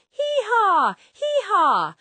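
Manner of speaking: high loud hee-haw bray; loud donkey bray. Acted. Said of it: "Donkey went".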